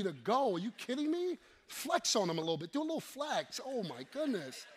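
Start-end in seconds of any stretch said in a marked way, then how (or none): none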